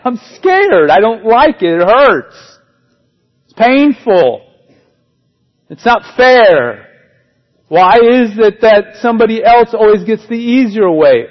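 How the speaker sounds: fearful whining protest against the work; whiny complaining believer. Acted. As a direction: complain like a hurt child. Voicing believers resisting God.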